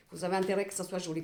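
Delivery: ordinary talk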